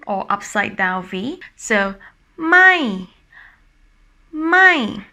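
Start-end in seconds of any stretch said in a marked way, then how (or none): 2.4-5.0 "mai" up high, then dropping down; falling tone. Shown as a demonstration. Teacher showing the upside-down V, falling tone "mai". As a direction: climb the hill on "mai", then come down. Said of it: "The voice go up first and then drops down".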